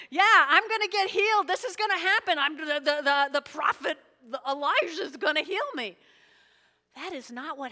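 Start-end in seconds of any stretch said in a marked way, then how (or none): 0.1-6.0 loud, raised gushing excitement; mock elation. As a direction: gush like an overjoyed man awaiting healing. Imagined overjoyed Naaman.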